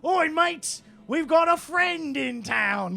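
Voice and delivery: high, loud mock British rocker; hammed-up British call, stretched out. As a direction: hail your mates in a broad British accent. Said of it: "[name] kind of, like, weirdly leans".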